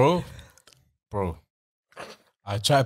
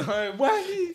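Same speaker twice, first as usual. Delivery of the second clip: laughing; 14 semitones higher than usual. Real, not acted. Laughing squeal.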